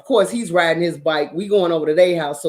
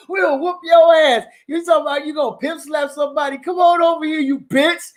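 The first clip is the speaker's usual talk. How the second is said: put-on kid trash talk; 8 semitones higher than usual. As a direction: taunt the bullies. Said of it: "We out there cussing".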